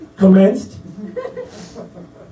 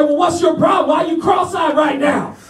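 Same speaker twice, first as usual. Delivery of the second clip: raised-pitch demanding questions; imitated drill sergeant; 6 semitones higher than usual. Sergeant demanding why trainee is cross-eyed, mock irate questioning. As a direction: interrogate like an irritated sergeant.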